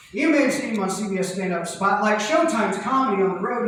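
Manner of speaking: ordinary talk, nothing marked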